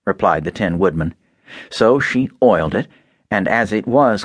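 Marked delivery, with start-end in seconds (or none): none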